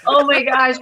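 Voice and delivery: hammed-up tearful cry, half laughing; fake-sob it like winning an award; mock tearful.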